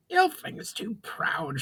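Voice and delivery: hammed-up indignant goblin cry; elderly goblin lightly shouting at the elf; mock shout. A narrator's reading. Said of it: "shouted the elderly goblin".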